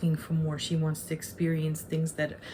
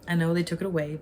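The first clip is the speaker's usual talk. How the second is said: normal talking voice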